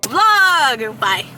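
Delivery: loud, high vlog yell; loud shout. Real, not acted. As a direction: belt it at the camera.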